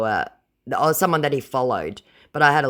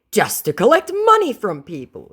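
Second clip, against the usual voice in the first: high, loud whiny voice; whiny scammer; 11 semitones higher, 9 dB louder than usual. Retold or imitated. Scammer explaining his plan, high and whiny. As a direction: explain it pitifully.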